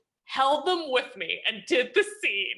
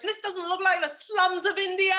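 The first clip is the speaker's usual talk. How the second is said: higher, put-on voice of a displeased star; Tim Roth complaining; 8 semitones higher than usual. Star actor complains to the director about the set, in a higher put-on voice. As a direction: sniff at the set like a fussy lead actor. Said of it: "And he's like".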